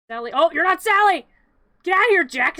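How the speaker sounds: high, loud scolding of an intruder; exasperated shout. Real, not acted. Storyteller chasing Jack out of Sally's scene, shouting. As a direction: shoo them away in playful alarm. Shooing wrongly appearing characters.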